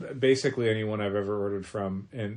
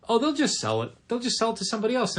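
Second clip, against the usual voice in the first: breezy clueless customer; 10 semitones higher, faster than usual. Retold or imitated.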